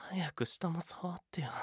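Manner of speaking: pleading unvoiced whisper. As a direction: beg softly in a whisper. Whispered plea for touch.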